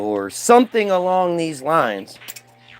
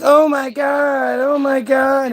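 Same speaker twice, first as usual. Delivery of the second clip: gushing excitement; 7 semitones higher, slower than usual. Retold or imitated. Crowd gushing over newcomer.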